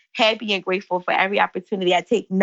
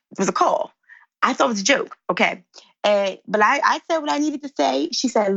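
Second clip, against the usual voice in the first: ordinary delivery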